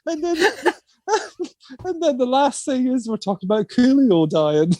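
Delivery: giggling at absurd news; can't stop laughing. Real, not acted. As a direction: talk through laughter.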